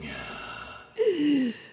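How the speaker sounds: a sharp high gasp of shock; dramatic gasp. Retold or imitated. Podcaster acting out the heroine's discovery, high shocked gasp. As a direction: gasp as if the truth just hit.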